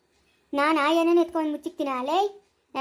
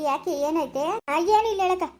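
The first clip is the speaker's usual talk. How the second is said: ordinary talk, nothing marked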